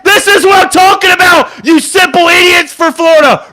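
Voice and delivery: high, loud, raging yells at the TV; furious shout. Real, not acted. Fan screaming at Florida's coaches, furious shout. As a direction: let the anger explode at the play-callers. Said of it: "Had to let that out because I'm getting pissed off".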